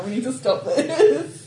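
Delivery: laughing, slow and loud; pleading through laughter. Real, not acted. Laughing plea to stop.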